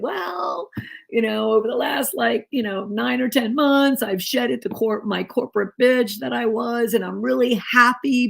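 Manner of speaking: high, strained voice on the verge of tears; pushing the words out as she breaks down; breaking down. Retold or imitated. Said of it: "that's when I broke down and I said".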